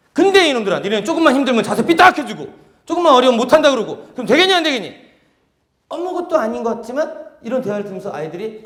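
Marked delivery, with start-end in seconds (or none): 0.1-4.9 loud playful scolding. Acted. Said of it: "박사님이 해주신 거예요".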